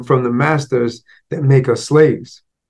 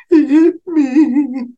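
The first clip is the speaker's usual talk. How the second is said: put-on much higher, slow whimpering child; crying little boy; 3 dB louder, much slower than usual. Boy tells his mother he was hit, high whimper. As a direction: sob it out like a five-year-old.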